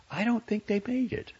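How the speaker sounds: high, lightly insistent receptionist; receptionist character. Acted. Voices a woman receptionist character.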